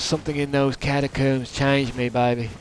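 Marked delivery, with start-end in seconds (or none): none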